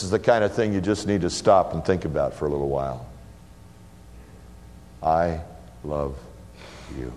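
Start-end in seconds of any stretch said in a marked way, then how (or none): 5.0-7.1 softer and lower, hushed; tender. Acted. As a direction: breathe it out with deep love. Repeated, drawn out hushed.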